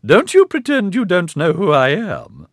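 put-on high, loud indignant old lady; stern old matriarch. A narrator's reading. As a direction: berate him in a wavering old voice. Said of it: "her wavery voice rising an octave".

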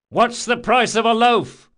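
high, loud challenge through a door; shouted. A narrator's reading. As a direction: call out the password question at full voice. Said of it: "he shouted".

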